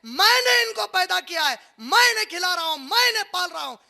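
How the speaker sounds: high, loud, slowed declaration; booming proclamation. Acted. God declares He feeds and raises people, booming. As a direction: thunder it out with authority. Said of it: "अब अल्लाह कहता है".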